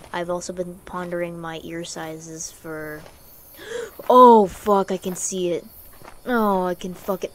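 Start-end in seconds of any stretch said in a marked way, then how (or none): 3.6-4.0 sharp high gasp; sudden intake of breath in fright; startled gasp. Real, not acted. Player scared by horror game, startled gasp. 4.1-4.9 real, very loud startled curse; startled yell. Real, not acted. Player jumps at horror game, startled yell.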